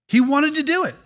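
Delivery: full-force preaching lift; preaching swell. Acted. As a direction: hit it like a sermon climax. Preacher peak emphasis.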